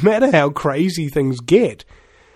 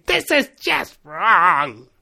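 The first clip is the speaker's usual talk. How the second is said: screaming inner voice; much slower than usual. Acted. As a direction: stretch it out like a screaming voice in your head. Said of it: "that voice screaming in your head".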